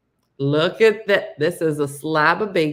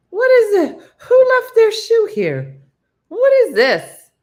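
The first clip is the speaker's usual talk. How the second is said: loud, very high mock outrage; appalled disbelief; 11 dB louder than usual. Teller sees the dessert brought to table, appalled disbelief. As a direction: shriek in comic disbelief.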